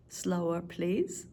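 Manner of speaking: ordinary delivery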